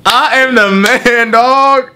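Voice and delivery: high, gleeful victory cry; excited boast. Real, not acted. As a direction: crow about winning like a champ. Boasts after naming ten fighters.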